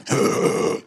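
loud mock exasperated huff; long, hammed-up breathy groan; huffy noise. Imitating secretary with attitude, loud exaggerated huff.

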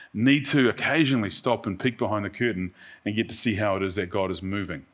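ordinary talk

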